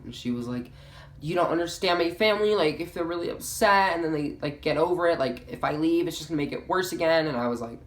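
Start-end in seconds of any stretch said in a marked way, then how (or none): 1.2-7.3 higher-pitched mock pleading; whiny excuse. Friend explaining her family over phone, mock pleading.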